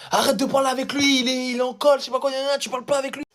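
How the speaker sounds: high, nagging imitation of the supervisor; mocking supervisor. Supervisor scolding the boy in the hallway, high nagging mimic.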